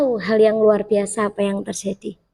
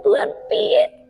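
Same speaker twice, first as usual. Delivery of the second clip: panicked whisper; 10 semitones higher, slower than usual. Retold or imitated. Panicked prayer to God.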